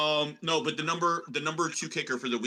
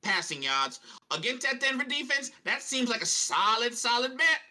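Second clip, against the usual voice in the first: put-on hammy prospector accent, pitched up; Deuce Chiggins; 5 semitones higher than usual. Co-host reads his under bets to the panel, prospector voice. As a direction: play a high-voiced old-time prospector rattling off bets. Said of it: "I don't know what accent I'm doing".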